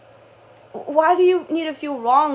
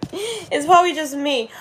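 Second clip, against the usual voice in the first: ordinary talk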